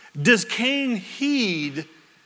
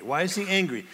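normal talking voice

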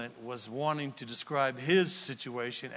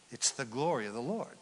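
ordinary talk

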